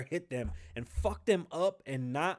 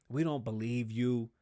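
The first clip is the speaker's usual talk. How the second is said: normal talking voice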